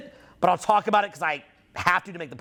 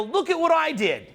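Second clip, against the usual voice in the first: high-pitched mock boast; mock bragging; 8 semitones higher than usual. Speaker imitates himself bragging about giving, high-pitched boast. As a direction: show off in a raised, bragging pitch. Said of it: "if you brag".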